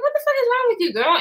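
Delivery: mock outrage squeaked up high; incredulous outburst. Telling viewers what she thought of the rude coworker, high mock outrage.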